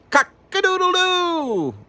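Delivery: very high, slow crowing; rooster crow. Acted. Rooster wakes Mister Piper, very high slow crow. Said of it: "crowed Little Mac again".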